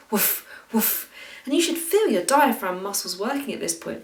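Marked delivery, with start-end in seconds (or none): 0.1-1.1 "woof, woof." with a long pushed F at the end; breathy woof. Shown as a demonstration. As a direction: lean into the F of "woof". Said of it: "really gonna enjoy the F sound at the end of woof".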